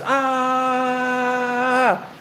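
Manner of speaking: long, high-pitched mock scream, held without extra volume; mock girly scream. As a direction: imitate him screaming like a girl on one sustained high note. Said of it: "screamed like the biggest bitch".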